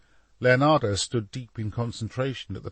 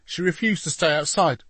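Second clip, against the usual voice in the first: higher-pitched, apologetic excuse; begging excuse; 7 semitones higher, 3 dB louder than usual. A narrator's reading. Soldier to captain after woman got past, begging. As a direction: plead your case to a superior. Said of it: "begged the soldier".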